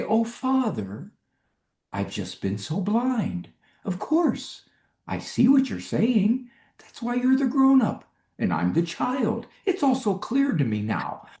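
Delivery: high, sugary mock child voice; mock grateful child. Father mocking the book child who sees the light, sugary and high. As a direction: parody the too-perfect child.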